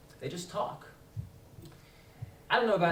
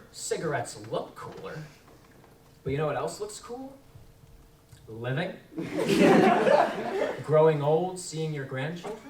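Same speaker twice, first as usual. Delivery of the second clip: ordinary talk, nothing marked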